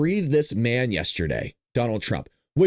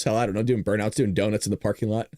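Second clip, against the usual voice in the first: ordinary talk, nothing marked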